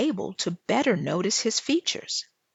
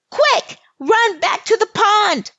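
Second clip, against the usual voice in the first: much higher, loud urgent shout; urgent cry; 13 semitones higher, 9 dB louder than usual. Acted. Lil Red shouting to her cousins to flee, high and loud. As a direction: yell to get them running.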